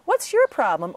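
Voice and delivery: high, loud, incredulous mock outburst; exasperated friend. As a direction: play the baffled friend who just doesn't get it. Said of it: "people throughout my life say".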